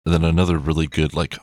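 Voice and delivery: ordinary talk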